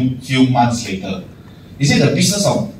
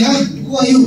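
higher, puzzled pretend question; mock confusion; 10 semitones higher than usual. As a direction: act like you have never seen them.

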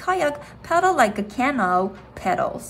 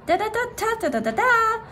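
bugle call; 12 semitones higher, faster than usual. A narrator's reading. The narrator imitating the morning bugle call at camp, sung high. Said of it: "the bugle blasted".

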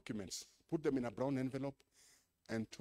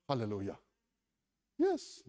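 ordinary talk, nothing marked

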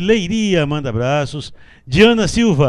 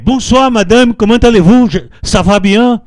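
mock French, louder and higher; a teasing French bonjour to a friend; playful French greeting; 6 semitones higher, 7 dB louder than usual. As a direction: ham up a cheerful Parisian hello. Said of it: "Então eu brinco com ela também".

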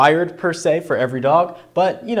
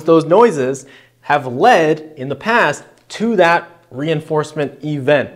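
ordinary talk, nothing marked

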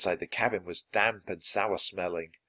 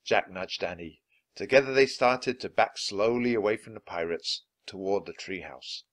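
ordinary delivery

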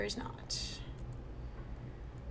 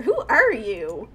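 usual speaking voice

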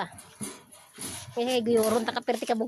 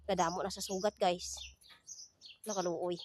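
soft pity; 6 semitones lower, 9 dB quieter than usual. Real, not acted. Pitying the scratched cat.

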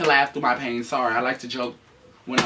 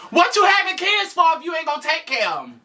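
sassy mock voice; 13 semitones higher, 6 dB louder than usual. Sassy butting in on partner.

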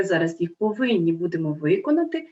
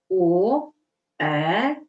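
ordinary delivery

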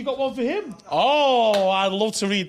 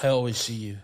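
love voice; 8 semitones lower, 4 dB quieter than usual. Pretend. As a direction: read the love poem tenderly. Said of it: "I'll start again".

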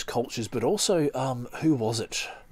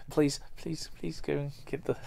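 mock pleading; 6 semitones higher, 5 dB quieter than usual. Begging son to fetch package.

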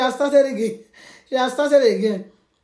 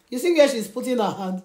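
ordinary talk

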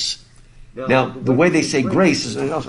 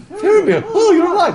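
much higher, a bit louder, hammed-up cry of relief; mock relieved father; 4 dB louder than usual. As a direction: imitate Denethor overjoyed for one second. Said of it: "for one second".

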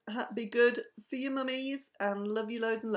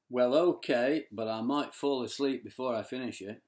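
sleepy low voice; 9 semitones lower than usual. Acted.

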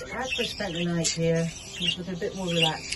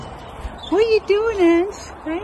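much higher, slow and louder squeal at the bird; squeaky high pet voice; 9 dB louder, slower than usual. Real, not acted. Repeats question to bird Ange.

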